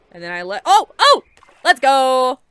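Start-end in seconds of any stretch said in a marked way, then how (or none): 0.7-1.2 high, loud yelps of excitement; thrilled yelp. Real, not acted. Thrilled while trying a dive. 1.6-2.4 loud, high victory yell; triumphant shout. Real, not acted. Celebrates a successful dive.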